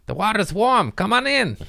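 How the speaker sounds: high-pitched put-on little kid voice; odd accented kid. Comedian voicing a Chernobyl kid beckoning swimmers, high silly accent. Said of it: "why he had that accent".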